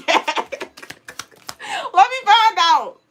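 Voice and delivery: ordinary talk, nothing marked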